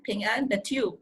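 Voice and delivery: ordinary delivery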